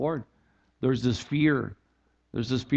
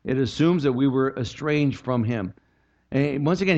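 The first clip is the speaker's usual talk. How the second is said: ordinary talk, nothing marked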